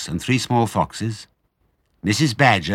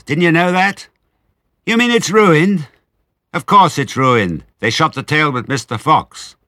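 higher, louder scolding voice; lightly voiced farmer's wife; 7 semitones higher, 5 dB louder than usual. A narrator's reading. Missus Bean scolds Mabel from upstairs, raised. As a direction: bark it down from upstairs. Exchange continues.

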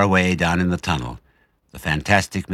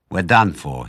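plain reading voice

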